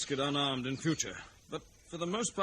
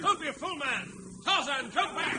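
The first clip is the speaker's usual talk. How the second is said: much higher, loud desperate call; urgent shout; 13 semitones higher, 4 dB louder than usual. Acted. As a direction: yell to stop him. Shouting after Tarzan.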